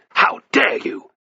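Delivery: slow, high, loud outrage; furious outburst. A narrator's reading. Batiatus leaps up at the slave's insult, furious. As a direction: explode in outrage at insolence. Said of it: "in furious summons".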